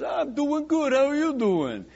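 a small businessman trying to stay cheerful; dry cleaner. Acted. Dry cleaner answers mobster.

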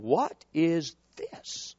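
slow, hushed bewildered mutter; quietly baffled at the ceremony; low slow disbelief; puzzled aside. Retold or imitated. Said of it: "I'm sitting there going".

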